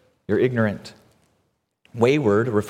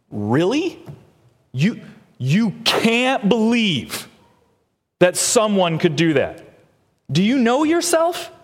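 incredulous retort; 9 semitones higher, slower than usual. Retold or imitated. Preacher answering judgmental Christians with mocking disbelief. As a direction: turn the question back on them. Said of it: "To which I think in my mind".

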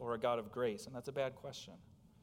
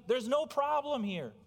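normal talking voice

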